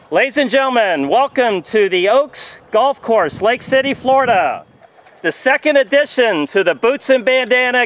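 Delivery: loud, slow announcer voice; booming tee-box introduction. Acted. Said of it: "I'm gonna announce it".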